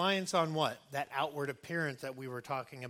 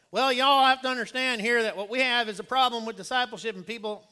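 loud, higher-pitched Arkansas pulpit voice; Arkansas accent; 7 semitones higher, 11 dB louder than usual. Acted. Somebody gets up at the pulpit, loud Arkansas accent. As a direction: put on a big Arkansas accent, projected to the congregation. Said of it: "someone with an Arkansas accent".

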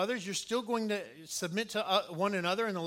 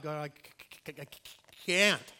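imitated stutter, stuck before a loud release; stuttering Moses; 4 dB louder than usual. Moses telling God he cannot speak, stuttering. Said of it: "or the stutter".

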